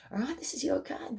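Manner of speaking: This is your card, breathed as a soft whisper. Acted. Reader whispering the card to the viewer. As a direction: whisper it like a secret. Tarot reader repeating the point.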